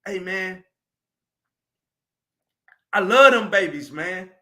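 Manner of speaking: ordinary talk, nothing marked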